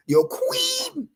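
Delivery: a sudden high, mocking squeak on queen; mocking squeal. Preacher mocking the followers' queen, high squeak. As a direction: sneer it up high like a joke.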